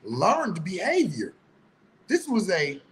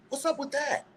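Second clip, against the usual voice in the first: sarcastic; 9 semitones higher, 6 dB quieter than usual. Real, not acted. Sarcastic question.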